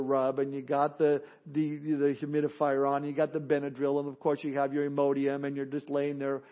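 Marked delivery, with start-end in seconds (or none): none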